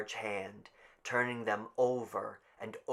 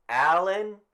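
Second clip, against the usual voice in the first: higher, louder call; raised roll call; calling out a name; 9 semitones higher, 10 dB louder than usual. Acted. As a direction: read the name out loud. Calling names.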